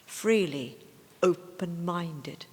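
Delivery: recited poetry voice, set apart from the chat; poem reading. Acted. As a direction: read it aloud as a recited love poem. Reads a love poem aloud.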